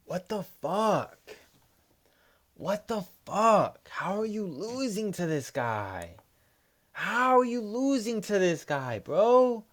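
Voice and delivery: high, strained, slow disbelief, rising to a near yell; wailing at the fighter in frustration; venting at a fighter who lost his pick; exasperated outrage. Real, not acted. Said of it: "This shit pisses me off".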